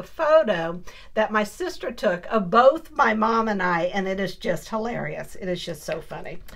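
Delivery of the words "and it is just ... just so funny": usual speaking voice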